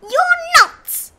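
high, loud indignant rabbit retort; snapping. A narrator's reading. Rabbit contradicting the big bear, loud high snap. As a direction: snap back sharply, way up high. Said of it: "snapped the rabbit".

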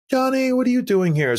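high, bright surprised call of his name; delighted teammate spotting you; excited greeting. Retold or imitated. Old teammate greeting him excitedly.

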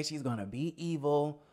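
normal talking voice

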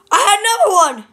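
high, loud exasperated cry; sudden exasperated yell; frustrated outburst. Real, not acted. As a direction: burst out loudly in frustration. Frustrated at forgetting an accent.

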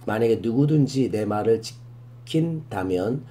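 ordinary delivery

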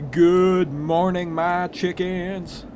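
put-on slow, drawn-out southern accent; attempted southern accent. Farmer greeting the chickens, attempted southern accent. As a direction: stretch each word in a bad southern drawl. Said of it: "how to do a southern accent".

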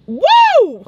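high, loud whoop; joyful woo; excited whoop. Real, not acted. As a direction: let out a happy holler. Loud exclaim.

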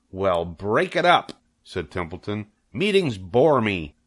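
bored rat. Acted. Templeton brushing off the barnyard meeting, slow and bored. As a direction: drag it out like a sulky rat.

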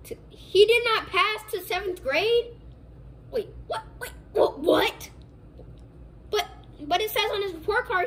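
can't believe what he's hearing; father hearing his son failed, stunned; shocked disbelief. Acted. Dad shocked on phone call.

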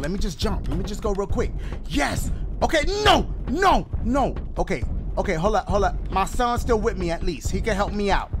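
ordinary talk

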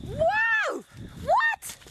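high shocked yell at the dog; real high shout of shock; startled shout. Real, not acted. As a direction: cry out at the ruined chair. Finds the chewed chair.

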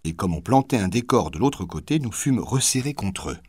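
plain reading voice

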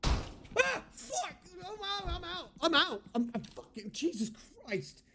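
high, breathless frightened cries; panicked. Real, not acted. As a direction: panic while running away. Fleeing a monster in panic.

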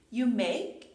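usual speaking voice

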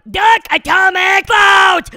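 high, loud, slow battle cry; hammed-up attack call. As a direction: announce the move like a superhero. Announcing a fart attack.

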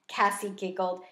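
usual speaking voice

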